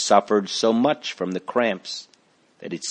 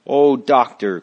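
louder, higher plea; begging; 4 semitones higher, 8 dB louder than usual. Acted. Christiana begging the doctor to save her boy. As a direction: throw the plea at him. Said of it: "Christiana begged".

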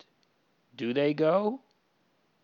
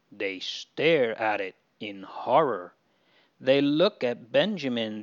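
usual speaking voice

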